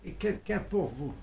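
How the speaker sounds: called out away from the phone; from another room. Acted. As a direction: voice far off from the receiver. Caller fetches seller, phone left. (appel lointain depuis une autre pièce)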